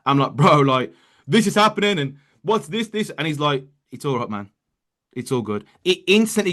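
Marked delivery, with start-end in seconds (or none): 0.3-3.1 panicky. Retold or imitated. Calling mentor in a panic. 3.9-5.6 softer and lower, easygoing mentor; calm mentor. Retold or imitated. Mentor's calm reply quoted.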